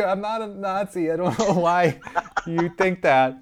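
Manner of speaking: high, laughing mock protest; flustered old man. Old driver denying being a Nazi, high laughing protest.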